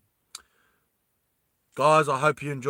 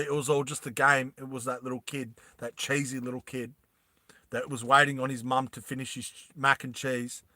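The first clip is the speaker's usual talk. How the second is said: ordinary talk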